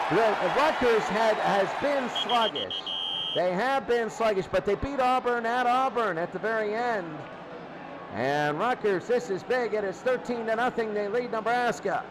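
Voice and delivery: normal talking voice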